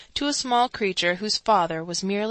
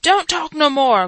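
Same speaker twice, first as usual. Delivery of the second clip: louder, slow rough growl; slow, louder gruff warning; 7 semitones higher, 9 dB louder than usual. A narrator's reading. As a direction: growl him into silence. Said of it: "growled the man under the window".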